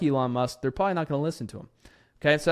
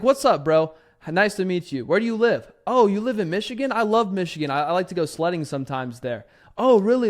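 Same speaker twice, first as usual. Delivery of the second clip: higher, louder buddy-buddy chat; friendly salesman; 4 semitones higher, 4 dB louder than usual. Retold or imitated. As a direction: chum up with the client. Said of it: "You get on there and say".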